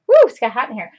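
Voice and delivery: usual speaking voice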